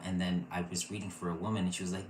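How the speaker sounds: usual speaking voice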